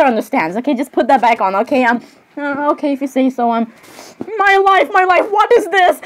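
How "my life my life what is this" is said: high, giggly cry of disbelief; overwrought outburst. Acted. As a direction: exclaim it like an overwhelmed fan.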